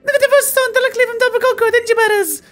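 very high put-on voice, breezy; high game character voice. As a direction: read the NPC way up high.

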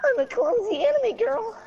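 put-on high squeaky anime girl; cute anime girl voice. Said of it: "you cannot do an anime girl voice".

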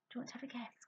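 whispered coaxing question to the puppet. Shown as a demonstration.

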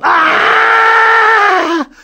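very high loud shriek; horrified scream. Acted. Mother screaming at Julie's face, very high loud shriek. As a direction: scream in shock. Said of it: "looked at her and yelled".

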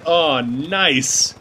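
ordinary talk, nothing marked

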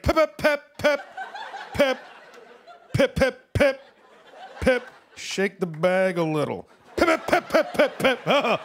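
short high pep sounds in bursts; imitated pee sputter. Morning bathroom trip, comic pee sputter noises. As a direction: sputter out little pep noises like a weak stream.

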